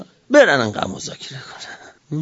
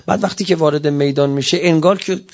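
ordinary talk

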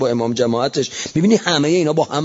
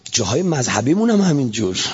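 normal talking voice

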